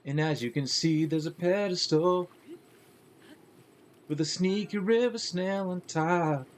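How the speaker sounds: slow tutorial voice. Acted. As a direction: talk it through like a tutorial. Tutorial guide voice.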